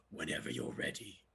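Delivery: deep, unfriendly put-on voice; forbidding studio man. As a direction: play the intimidating boss, low and cold. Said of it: "everything was negative energy".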